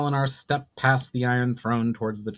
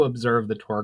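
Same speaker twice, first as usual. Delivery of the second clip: ordinary delivery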